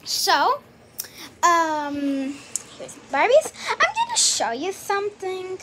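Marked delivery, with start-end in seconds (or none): none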